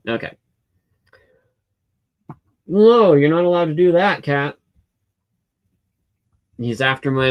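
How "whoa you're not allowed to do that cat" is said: loud, surprised warning to the cat; startled scolding. Real, not acted. Telling the cat off as it goes after the mouse, loud and startled. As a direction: yelp it out to stop the cat. Cat jumps somewhere forbidden.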